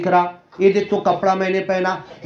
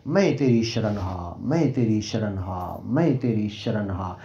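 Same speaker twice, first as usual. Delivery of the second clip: softer and lower, a repeated private prayer; quiet surrender; 9 semitones lower, 6 dB quieter than usual. Shown as a demonstration. Devotee repeating the prayer to the Lord, low and soft. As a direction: murmur it low and soft, not shouting. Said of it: "मैं चिल्ला के नहीं बोल रहा".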